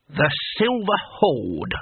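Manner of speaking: slow, proud naming of the gang; touch of pride. A narrator's reading. Cohen proudly naming his band to Rincewind, slow and lightly proud. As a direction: lightly savour the name with pride. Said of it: "said Cohen with a touch of pride".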